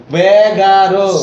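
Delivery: বেগারো said loudly wrong for এগারো; বেগারো, a planted misreading; deliberate mistake. Shown as a demonstration. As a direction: misread এগারো as বেগারো on purpose to test them. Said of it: "যদি ভুল করি".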